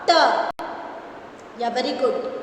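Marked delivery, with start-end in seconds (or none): 0.1-0.1 T said alone, crisp and separate; isolated T sound. Shown as a demonstration. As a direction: sound out just the letter T. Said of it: "have ending sound?".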